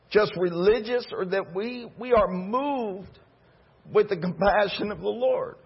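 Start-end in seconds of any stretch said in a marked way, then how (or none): none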